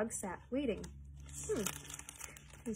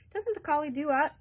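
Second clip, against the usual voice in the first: put-on higher, louder child's call; lightly voiced shouting child; child crying out; 6 semitones higher, 7 dB louder than usual. A narrator's reading. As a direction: pipe up like an eager child. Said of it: "a child cried".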